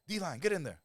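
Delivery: high, loud coach's call; shouting across the practice field. Retold or imitated. Coach calling out drill.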